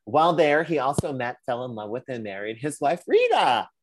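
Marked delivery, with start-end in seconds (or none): 3.1-3.7 "Rita" high and loud, then falling; big emphasis. Real, not acted. Host naming the wife he married, heavy emphasis on "Rita". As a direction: punch out "Rita" like a grand reveal. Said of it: "I don't know why I said that with such, uh, emphasis".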